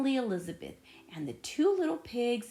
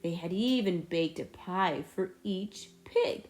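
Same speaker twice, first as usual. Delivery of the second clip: ordinary delivery, nothing marked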